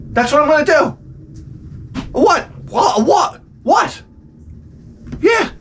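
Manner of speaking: high-pitched, challenging retorts; mock defiance. Man threatening payback to mother-in-law, mock defiant. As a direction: throw out short squeaky challenges with pauses.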